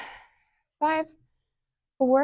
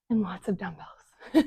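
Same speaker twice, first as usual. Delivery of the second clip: whispered aside; 5 semitones lower, 14 dB quieter than usual. Real, not acted. Playful aside about buying equipment.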